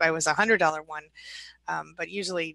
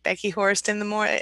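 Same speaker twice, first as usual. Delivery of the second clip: normal talking voice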